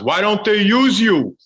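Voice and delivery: high, loud, slow put-on fan voice; mock fan question. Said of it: "the one I always got was".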